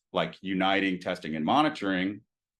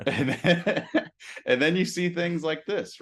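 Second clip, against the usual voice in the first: laughing; 8 semitones higher than usual. Real, not acted. Laughing at failing demo.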